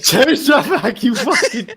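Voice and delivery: laughing. Real, not acted. Laughing protest at avatar.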